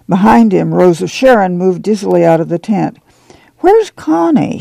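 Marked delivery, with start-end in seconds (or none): 3.6-4.6 high, slow, whiny demand; a sulking pregnant girl asking for her husband, irritably; irritable. A narrator's reading. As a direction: ask it peevishly, pitched way up. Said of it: "she asked irritably".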